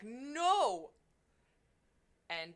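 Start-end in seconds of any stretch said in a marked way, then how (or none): 0.0-0.9 a sudden loud cry of no; shout. Retold or imitated. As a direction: yell it to stop them. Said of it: "Tirlon's like".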